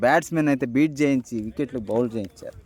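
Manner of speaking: lively play-by-play call; cricket commentary. Real, not acted. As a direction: call the match live.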